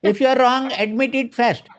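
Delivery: high, flat robot reading. Shown as a demonstration. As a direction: rattle it off like a robot. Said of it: "I can just read like a robot".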